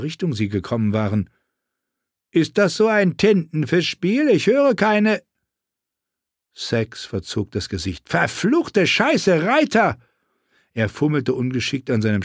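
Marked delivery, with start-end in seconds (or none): 2.3-5.2 sneering, raised voice of a coarse knight; mock her with crude contempt. A narrator's reading. Arrogant knight taunting captive woman, sneering and raised. Sachs scoffing. 8.1-10.0 slow, raised oath then a sharp cry; startled curse. A narrator's reading. Knight spotting riders in the storm, raised alarmed curse.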